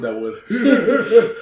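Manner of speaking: high, loud laughing repeat. Real, not acted.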